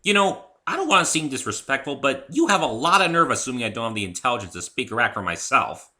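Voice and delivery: loud, high indignant rant; insulted and angry. Acted. The human rebukes Rainbow Dash, loud and angry. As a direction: tell them off, offended. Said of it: "Insulted and angry".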